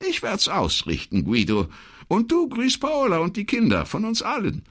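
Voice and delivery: a chatty, friendly wife on the phone; older woman's voice. Acted.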